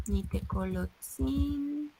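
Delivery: "Nitecolotzintle" drawn out softly with held syllables; soft, stretched-out "Nitecolotzintle" for learners; slow sounding-out. Shown as a demonstration. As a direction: say "Nitecolotzintle" very slowly and softly, lingering on the end. Teacher sounding out a long word.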